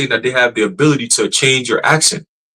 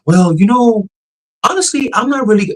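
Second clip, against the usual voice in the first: high-pitched, hesitant musing after a sigh; imitated thoughtful AI tone; 10 semitones higher than usual. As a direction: mimic a chatbot pondering, pitched way up. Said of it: "the pitch, tone".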